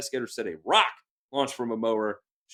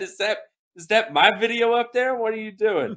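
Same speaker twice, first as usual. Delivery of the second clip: high, louder, startled mock quote; imitating Kenny; 9 semitones higher, 6 dB louder than usual. Host quoting Kenny seeing his video, high startled mock voice. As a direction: play Kenny caught off guard. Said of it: "Kenny said".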